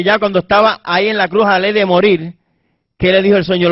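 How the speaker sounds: ordinary delivery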